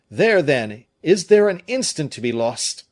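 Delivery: loud, high, pressing question; no time to lose; urgent cry. A narrator's reading. The friends urge Fouquet to flee, crying urgently. Urging instant flight.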